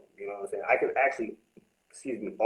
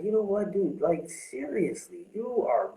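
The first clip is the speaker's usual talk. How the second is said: high, slow self-scolding; talking sense into his own drunk self; scolding himself; 9 semitones higher, slower than usual. Retold or imitated. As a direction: tell yourself off, drawn out. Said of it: "I was just like".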